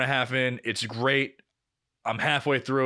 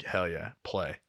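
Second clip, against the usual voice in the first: quiet; 6 semitones lower, 7 dB quieter, slower than usual. Retold or imitated. Girlfriend asleep, snoring.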